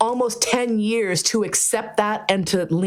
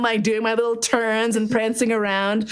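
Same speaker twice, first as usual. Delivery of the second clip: ordinary delivery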